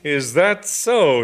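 slow, loud furious challenge. Acted. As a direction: spit it out slowly. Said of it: "eyes glittered furiously".

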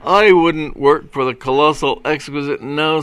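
loud, high, huffy refusal; petulant. A narrator's reading. Second patrolman spurning the film studio, petulantly. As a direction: refuse with offended artistic pride. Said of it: "with a petulant twitch of his shoulder".

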